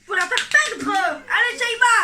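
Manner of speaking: high, loud call to Shaima; rally her on with a shout; cheering shout. Real, not acted. Cheering someone on.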